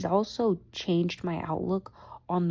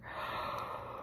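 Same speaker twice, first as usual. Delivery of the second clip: tired gasp; 13 dB quieter than usual. Real, not acted. Exhausted after work.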